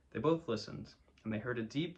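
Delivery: plain reading voice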